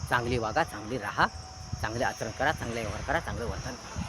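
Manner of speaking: low, soft moral counsel; hushed, low-pitched sermon advice; subdued preaching. Real, not acted. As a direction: drop the voice and exhort quietly.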